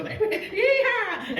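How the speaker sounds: high, loud hollered hi-ha; party yee-haw. Acted. Host whipping up the party crowd with a yee-haw. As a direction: whoop it like a cowboy.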